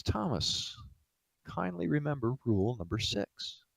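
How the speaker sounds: soft, composed gentle rebuke; calm reminder. Acted. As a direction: quietly rein in a frantic aide. Host calmly rebukes frantic aide.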